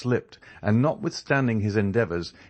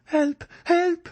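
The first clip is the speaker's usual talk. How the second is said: much higher, louder calls for help; crying for help; 5 dB louder than usual. A narrator's reading. D'Armilly calls the house for help, loud and high. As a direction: shout for help in alarm. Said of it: "cried Mademoiselle d'Armilly".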